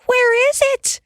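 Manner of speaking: wife afraid the mouse is still in bed; cried out. Acted. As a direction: shriek at the thought of a mouse. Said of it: "Ma cried out".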